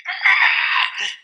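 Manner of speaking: mock toddler shriek, high and loud; toddler scream. Father imitating his daughter's timeout protest, high loud shriek. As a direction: shriek like a two-year-old put in timeout. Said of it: "she was like".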